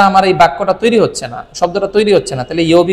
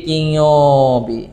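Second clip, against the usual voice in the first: slow spelled-out word; 3 semitones lower, much slower than usual. Shown as a demonstration. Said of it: "খেয়াল করে দেখেন".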